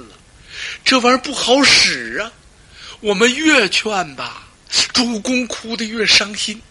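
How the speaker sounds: pleading officials. Acted. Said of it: "心里说".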